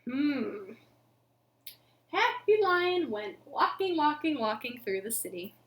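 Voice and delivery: usual speaking voice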